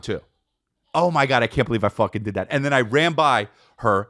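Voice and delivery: usual speaking voice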